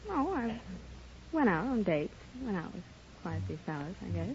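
ordinary talk, nothing marked

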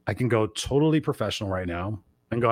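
ordinary delivery

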